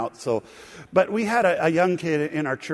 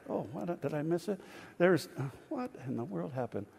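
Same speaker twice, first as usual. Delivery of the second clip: puzzled aside; 14 dB quieter than usual. Real, not acted. Distracted by something in the room.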